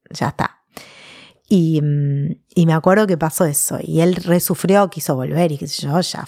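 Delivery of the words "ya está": a breathy whispered ya está; whispering the end of a brush-off; whispered dismissal. Retold or imitated. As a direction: let it fall away into a whisper. Retold rejection, trailing off.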